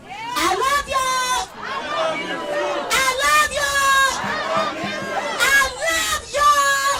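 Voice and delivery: high, slow shouted chant; crowd call shout. Real, not acted. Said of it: "you heard me?".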